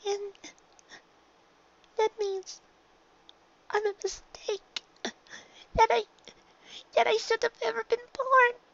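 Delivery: high filly voice, hurt and unsure; a small filly afraid she was a mistake; young filly Dinky, hurt. A narrator's reading. Filly telling her mother she feels unwanted, high and hurt.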